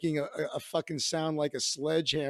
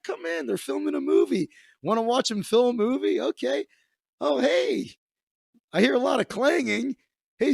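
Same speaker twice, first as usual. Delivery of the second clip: much higher, louder cartoon-chipper voice; mock cheerful greeting; 8 semitones higher, 4 dB louder than usual. Storyteller replaying his friend's welcome on the set, high and chipper. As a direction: play it as an over-bright, sing-song host.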